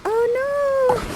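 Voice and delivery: very high, drawn-out mock dismay; high theatrical "oh no"; Miranda. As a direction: wail it like a distressed heroine, hammed up. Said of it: "And Miranda, you're like".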